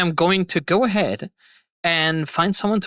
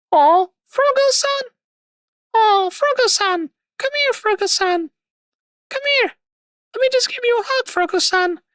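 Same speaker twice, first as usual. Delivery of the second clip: put-on much higher, sing-song anime girl calling sweetly; cutesy waifu voice. Streamer answering chat's waifu request to Froggo, high cutesy waifu voice. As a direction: coo at him like a doting anime waifu. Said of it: "You want me to make a waifu voice acting sound".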